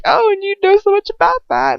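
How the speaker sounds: high, loud sarcastic sneer; mock him with scorn. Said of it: "he's just like".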